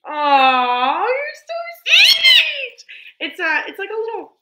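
high, loud gushing with laughs; excited squeal. Real, not acted. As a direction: gush over the gift. Thrilled over gifts from an artist.

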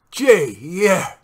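usual speaking voice

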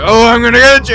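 loud, high imitation of an attacker; hammed-up, excited mock menace; mock monster threat. Player imitating the monster that came at him, loud mock threat. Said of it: "He's like".

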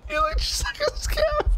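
high, squeezed out through real laughter; laughing. Real, not acted. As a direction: barely get it out for laughing. Helpless laughter after shooting a teammate.

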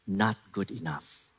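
low, soft and slow, dismissive; unimpressed wife. Retold or imitated. Wife dismissing husband's vague apology, low and slow. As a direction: drag it out, unconvinced.

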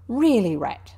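loud, peevish rebuke; huffy and fed up; pettish. A narrator's reading. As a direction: chide a friend pettishly. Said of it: "said the Mole quite pettishly".

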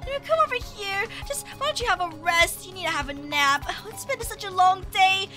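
soft, low tender coaxing; soothing mother. Acted. Mother coaxing her baby to nap, soft and soothing. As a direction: settle a baby gently, voice dropped low.

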